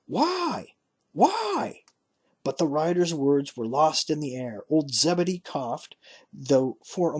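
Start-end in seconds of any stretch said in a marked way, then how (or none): 0.1-1.8 much higher, rising cries into the wind; desperate why. Acted. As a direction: cry after him, high and lost. Father's words lost in air.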